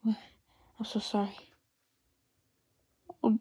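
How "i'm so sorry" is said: hushed, slow apology; soft condolence. Acted. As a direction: whisper sorrow to the grieving sister. Comforting grieving sister.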